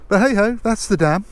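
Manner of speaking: high, loud sing-song hey ho; sing-song brush-off. Real, not acted. Vlogger shrugging off the film dam, high sing-song. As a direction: toss it off brightly and move along.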